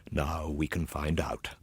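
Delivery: slow, trembling whisper; fearful whisper. Acted. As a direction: hush it over the coffin in dread. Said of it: "pale-faced and trembling all over".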